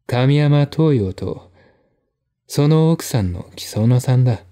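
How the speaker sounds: ordinary delivery, nothing marked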